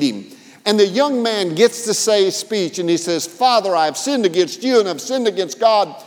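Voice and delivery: loud, fervent storytelling sermon; preaching. Acted. Preacher retelling the prodigal son's homecoming, fervent and loud. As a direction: preach it out with building energy. Retells parable with rising fervor.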